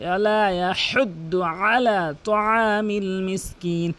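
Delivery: very slow chant with long vowels; measured tajweed, throat letters fully pressed; Quran recitation. Shown as a demonstration. (ধীর টানা কোরআন তেলাওয়াত)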